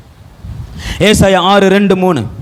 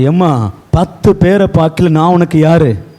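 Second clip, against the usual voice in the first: tender consoling husband; 2 semitones lower than usual. Acted. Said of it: "Elkanah சொல்றா".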